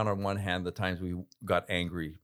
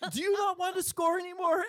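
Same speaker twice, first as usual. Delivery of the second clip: very high, mocking imitation of a teammate's rant; hammed-up high-pitched indignation; mock yelling teammate. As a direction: repeat the scolding in a squealed, incredulous voice. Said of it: "he starts yelling at me".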